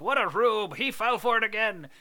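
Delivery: lightly acted old-time ribbing; twenties mockery. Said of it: "in the twenties, they'd be like".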